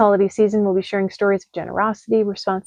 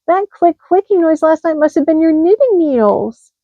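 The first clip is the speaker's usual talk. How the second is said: excited hedgehog; 8 semitones higher, 4 dB louder than usual. Acted. Hedgehog realises.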